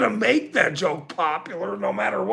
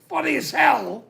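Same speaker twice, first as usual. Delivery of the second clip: high, emphatic quoted insistence; retold insistence; 6 semitones higher than usual. Quoting himself telling friends the joke is funny, raised and emphatic. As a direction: insist it's hilarious like he told them.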